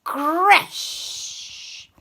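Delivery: high airy crash effect; crash sound. Acted. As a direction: make the crashing noise. House smashed.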